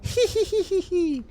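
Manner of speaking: high, slow giggling clown voice; sing-song creepy clown giggle; silly clown. Acted. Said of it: "you just hear the clown voice say".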